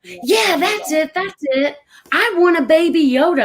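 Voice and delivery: high-pitched, eager mom imitation; excited mother. Retold mother suddenly excited at recognition.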